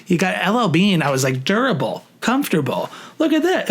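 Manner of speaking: mock salesman pitch, rising high on the last line; hammed-up sales pitch working the floor; a playful store clerk hard-selling a shirt. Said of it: "became an L L Bean fucking salesman".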